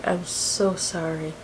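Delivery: slow, soft condolence; grave apology. Retold or imitated. State policeman breaking news to the wife, grave. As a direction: retell bad news gently and slowly.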